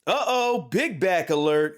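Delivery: high, loud mock alarm; taunting announcer. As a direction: sound the alarm like a rude machine.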